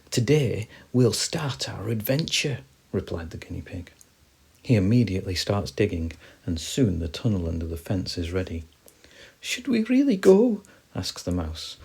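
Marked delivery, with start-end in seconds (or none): 0.1-2.6 lightly voiced guinea pig, slow and lifted; keen guinea pig. Guinea pig replying to the mouse, lightly voiced and keen. 9.4-10.6 high, loud, anxious little mouse voice; worried mouse. Acted. Mouse unsure about leaving, high and worried. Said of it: "still a little worried".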